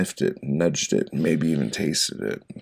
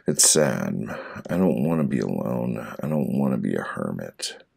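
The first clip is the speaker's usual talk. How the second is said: normal talking voice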